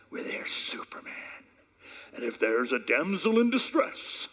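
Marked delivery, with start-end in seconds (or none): 0.1-1.1 a secret shared with the room; whispered aside. Acted. Preacher joking to wives, whispered aside. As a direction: whisper the punchline conspiratorially. Joke about men.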